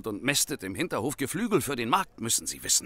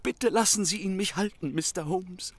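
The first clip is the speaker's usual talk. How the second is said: normal talking voice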